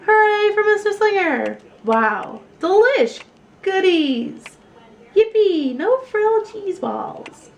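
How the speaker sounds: high, loud cheers; cheering. A narrator's reading. The class cheering for their teacher, loud and high. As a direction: whoop each word with joy.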